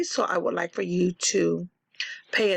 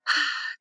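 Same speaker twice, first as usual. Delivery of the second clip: wistful sigh; 7 semitones higher than usual. Acted. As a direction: sigh up at the moon. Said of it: "with a sigh".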